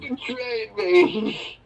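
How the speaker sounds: high, slow heartbroken line; anguished Obi-Wan. Retold or imitated. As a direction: accuse a lost brother. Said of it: "Revenge of the Sith".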